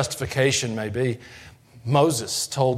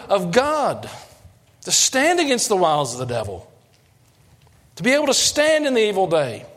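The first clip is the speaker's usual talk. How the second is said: fervent preaching; 9 semitones higher than usual. Acted. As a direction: preach it with rising fire to the congregation. Preaching builds to full force.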